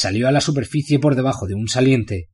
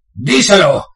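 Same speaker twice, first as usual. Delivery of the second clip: hissed order; 8 semitones higher, 7 dB louder, slower than usual. Acted. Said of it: "dijo Bane al oído del alcaide".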